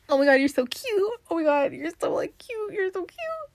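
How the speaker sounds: high, softening mock gush; gushing admirer. Imitating the person who keeps calling her cute, gushing. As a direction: imitate a fawning admirer, pitch climbing.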